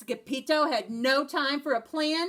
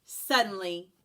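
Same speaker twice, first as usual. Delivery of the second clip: plain reading voice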